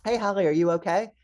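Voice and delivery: lightly acted nurse greeting a patient; nurse checking in. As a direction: call over to a patient to check on them.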